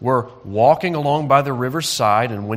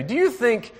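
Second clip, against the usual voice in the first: ordinary delivery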